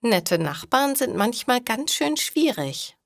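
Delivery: ordinary talk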